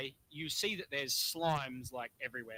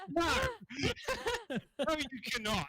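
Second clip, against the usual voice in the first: laughing; 11 semitones higher than usual. Real, not acted. GM laughing at player request.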